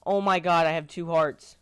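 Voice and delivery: frantic loud yelling; panicked shouting, voice high; panicking gamer. Real, not acted. Dying in fire in game.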